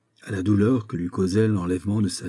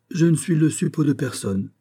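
higher, proud and composed denial; dignified; 7 semitones higher than usual. Acted. The magistrate rebuffs the count's insult with dignity. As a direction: stand tall and refuse the charge. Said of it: "répondit avec dignité".

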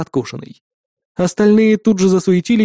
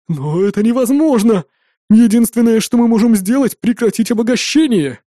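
alarmed protest; 6 semitones higher, 4 dB louder than usual. A narrator's reading. Engineer protesting to armed captor.

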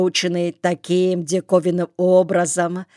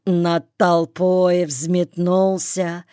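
usual speaking voice

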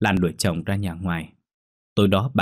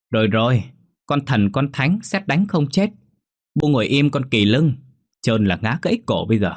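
put-on higher, loud, an old woman railing at her family; shrill bickering old mother; 6 semitones higher, 4 dB louder than usual. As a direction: squabble with the daughter-in-law, pitch up and loud. Mẹ chồng nàng dâu cãi. (mẹ chồng cãi con dâu, giọng cao và to)

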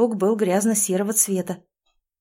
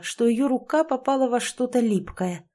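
plain reading voice

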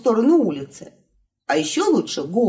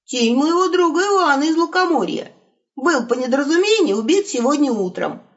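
rattled off report; 7 semitones higher than usual. Acted. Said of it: "без запинки отрапортовал волк".

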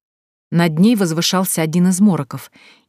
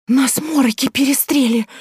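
breathless blurt; 8 semitones higher than usual. Acted. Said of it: "задыхаясь, выпалила".

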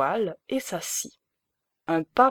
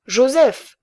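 loud, higher call across the shop; shouting; 6 semitones higher, 8 dB louder than usual. A narrator's reading. Baron calling the stove-fitter away, loud shout. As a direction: yell the name to send him off. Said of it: "cria-t-il au fumiste".

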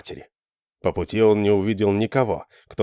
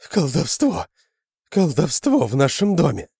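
frantic cry; 6 semitones higher than usual. Acted. Terrified man raising alarm.

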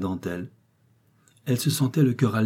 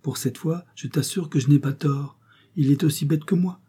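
mischievous; 7 semitones higher than usual. A narrator's reading. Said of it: "fit Jean d'un air malicieux".

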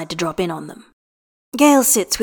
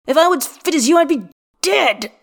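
pained complaint; 6 semitones higher, 3 dB louder than usual. A narrator's reading. Gale complains during stretching.

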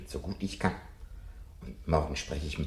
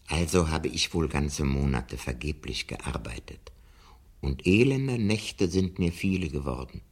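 reading scripture; 3 semitones lower, 5 dB louder than usual. Acted. Professor reading Job aloud to Montag, solemn. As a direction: read the verses like a solemn psalm. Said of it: "Aus dem Buch Hiob".

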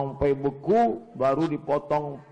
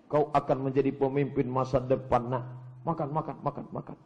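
hushed coaxing, fading softer; tender parent; 5 dB quieter than usual. Acted. Parent urges child to eat and lead, soft coaxing. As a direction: murmur encouragement while feeding a child.

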